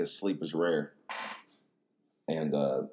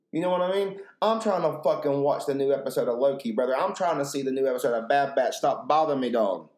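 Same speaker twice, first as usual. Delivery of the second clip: raised, louder pestered-viewer rant; playfully fed up, pitched up and forceful; mock exasperation; 6 semitones higher, 4 dB louder than usual. Griping to Janice after the twenty-fifth call, mock exasperated.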